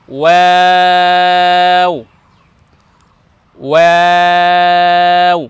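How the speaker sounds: ওয়াও drawn out, lips rounded; teacher modelling the letter slowly. Shown as a demonstration. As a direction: hold ওয়াও long and round. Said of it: "ঠোঁট গোল করতে হবে".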